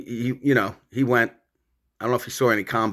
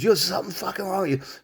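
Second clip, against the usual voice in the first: higher, spooked voice for his buddy TJ; imitating TJ; 8 semitones higher than usual. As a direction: play the freaked-out friend, pitched up. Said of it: "He goes".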